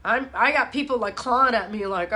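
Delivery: ordinary talk, nothing marked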